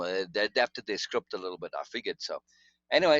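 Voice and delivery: ordinary talk, nothing marked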